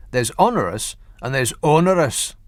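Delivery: a hint of Scottish on the second "onerous", rolled r; Scottish accent. Shown as a demonstration. Presenter showing how a Scot says it, light Scottish accent. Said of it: "See? Better".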